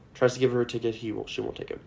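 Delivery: normal talking voice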